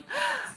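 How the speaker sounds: sharp, very high mock gasp of betrayal; dramatic gasp. Gasping as a clingy partner left behind. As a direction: gasp as if abandoned, hammed up. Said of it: "and I'm like".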